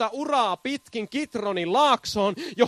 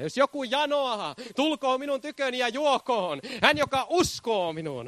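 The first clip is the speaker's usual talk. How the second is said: ordinary talk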